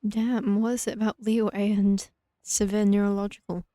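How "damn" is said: ordinary delivery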